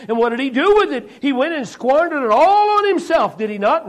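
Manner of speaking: usual speaking voice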